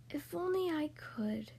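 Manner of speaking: soft, slow whispered wish; wistful whisper. Acted. Lonely duckling wishing to fly with the swans, slow whisper.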